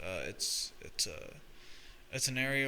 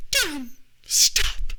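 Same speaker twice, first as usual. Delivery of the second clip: loud mock wail, starting very high; tearful mother; 10 dB louder than usual. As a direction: imitate an upset mother begging loudly. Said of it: "my mother's, like, in tears".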